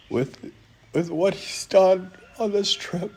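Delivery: choked up and barely holding it together, pitch cracking upward; overcome with emotion. Real, not acted. Founder moved to tears by a miracle trip, voice breaking high. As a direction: voice breaking high, then going soft with tears. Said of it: "that I'm emotional at this point".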